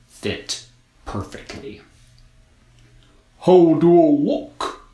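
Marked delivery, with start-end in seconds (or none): 3.4-4.9 loud snowman voice. A narrator's reading. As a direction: ask as a vain snowman. Snowman asks girl.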